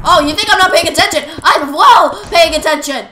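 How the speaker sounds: high, very loud mock outrage; indignant yelling. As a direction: yell it back in indignant protest. Snaps back loudly during game.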